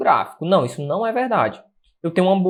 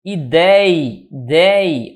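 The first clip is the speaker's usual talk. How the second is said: DEI punched loud in "idei, dei"; stressed syllable; 4 dB louder, much slower than usual. Shown as a demonstration. Teacher showing where ideia was accented, stressed syllable. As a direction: lean hard on the syllable "dei". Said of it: "a acentuação era aqui".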